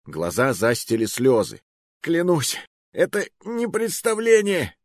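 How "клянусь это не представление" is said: very high, agonised plea; barely squeezed out. A narrator's reading. Tearful hostage swearing with arm twisted, barely squeezed out. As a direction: swear it in a strained, climbing voice. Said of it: "еле выдавил я".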